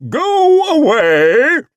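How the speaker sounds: very high, loud, slow boom. Acted. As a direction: drag it out grandly. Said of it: "said a booming voice".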